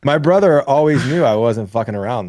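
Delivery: ordinary talk, nothing marked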